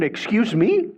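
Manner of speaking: high, startled excuse me; shocked king. Retold or imitated. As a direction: act out the king's stunned disbelief. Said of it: "The king went".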